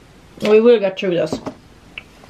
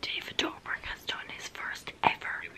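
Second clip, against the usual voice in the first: lower, breathy, urgent whisper; excited whisper; 14 semitones lower, 17 dB quieter than usual. Real, not acted. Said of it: "I'm whispering because it's late".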